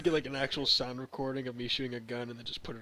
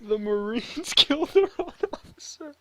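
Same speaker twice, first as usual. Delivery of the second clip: laughing; 14 semitones higher, 8 dB louder than usual. Real, not acted. Laughing at the story.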